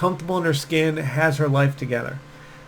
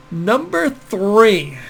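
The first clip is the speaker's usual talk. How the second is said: slow list announcer; 6 semitones higher, 5 dB louder, much slower than usual. Shown as a demonstration. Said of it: "slow list announcement".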